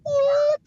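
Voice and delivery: a sudden high-pitched whoop. Acted. Hype man whooping at the panel, high yelp.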